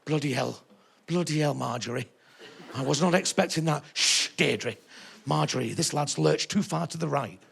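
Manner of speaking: a hint of a scandalised audience member whispering to her friend; prim old lady. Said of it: "nudging each other going".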